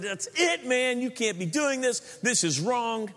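high, outraged complaint; indignant religious crowd. Retold or imitated. Religious crowd scolding Jesus for helping sinners, high and outraged. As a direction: scold like an offended church elder. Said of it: "the religious crowd said".

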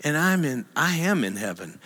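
ordinary delivery

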